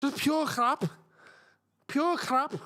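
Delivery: mock Scouse. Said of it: "I could never do Scouse".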